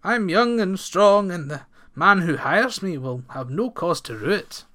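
a big lad cheerfully asking the farmer for work. Acted. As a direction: sell yourself with hearty cheer. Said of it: "said Ranald cheerfully".

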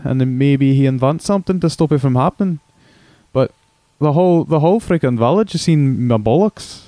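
hammed-up Scottish brogue; broad comic Scottish accent. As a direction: play a crude Scotsman caller on the radio. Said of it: "crude impression of a Scotsman".

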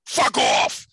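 rough, hoarse mock yell; retold angry curse. Retelling the scammer's last words before hanging up, mock angry. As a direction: imitate a scammer cursing before slamming the phone down. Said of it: "he cursed me out and slammed down his phone".

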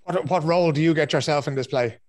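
usual speaking voice

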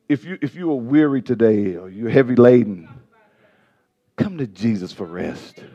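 low, soft pastoral appeal; hushed, soothing preacher's voice; tender invitation. Real, not acted. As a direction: drop down gently to comfort the congregation.

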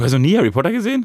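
fast, high, loud disbelief; surprised. Real, not acted. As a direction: blurt it out in disbelief. Disbelief at co-host's spoiler complaint. (ungläubig überrascht)

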